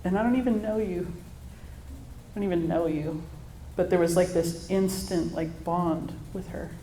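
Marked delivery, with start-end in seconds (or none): 1.1-3.2 softer and much slower, tender; tender hush. Real, not acted. New mom softly marveling at her newborn daughter. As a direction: let it drop to an awed hush.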